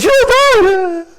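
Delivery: very high, loud squealing denial; daughter's squeal. Retold or imitated. Daughter squealing back at her father. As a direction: squeal it like a startled young girl. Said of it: "And she goes".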